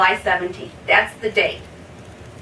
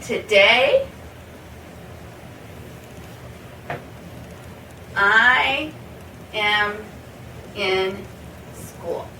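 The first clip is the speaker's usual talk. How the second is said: each word spaced out with long pauses; slow word by word; much slower than usual. Shown as a demonstration. Teacher to student, slow word-by-word model sentence. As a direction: lay it down one word at a time. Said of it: "For example".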